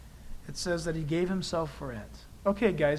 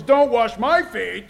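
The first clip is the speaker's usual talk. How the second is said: loud, slow, emphatic refusal; forceful mock-offended objection; indignant protest; 3 semitones higher, 8 dB louder, slower than usual. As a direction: act out an offended disciple, loud and drawn out. Peter's sensitive protest, acted.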